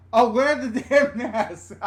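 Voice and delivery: slow, laughing realisation; real laugh breaking through the words; cracking up. Real, not acted. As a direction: burst out laughing as the joke lands. Cracks up reading chat joke.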